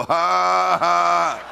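loud, sustained, deliberate "ha ha ha"; defiant mock laugh. Shown as a demonstration. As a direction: answer the devil with a put-on laugh. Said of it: "And you go".

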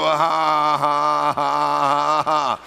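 loud, sustained, deliberate "ha ha ha"; defiant mock laugh. Shown as a demonstration. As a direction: answer the devil with a put-on laugh. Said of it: "You go".

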